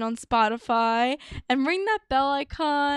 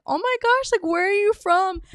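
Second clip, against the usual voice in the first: much higher, thrilled question; girl hearing an accent, gushing excitedly; 7 semitones higher, 4 dB louder than usual. Retold or imitated. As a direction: squeal it out, delighted by the accent.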